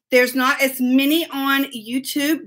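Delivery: ordinary talk, nothing marked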